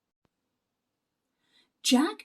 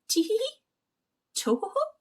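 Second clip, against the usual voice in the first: tee-hee giggle; 10 semitones higher, faster than usual. Acted. Leprechaun's hidden giggle.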